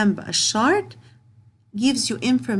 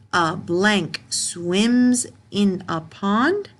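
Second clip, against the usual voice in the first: slow reading; much slower than usual. Shown as a demonstration. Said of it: "teacher reads sentence slowly".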